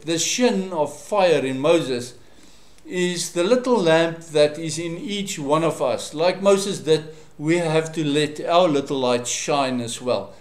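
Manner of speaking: ordinary talk, nothing marked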